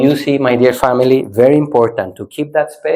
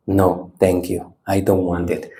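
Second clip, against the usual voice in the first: calm refusal; 7 semitones lower, 6 dB quieter than usual. Shown as a demonstration. Teacher politely turning anger away, calm refusal. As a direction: decline it quietly and unhurriedly.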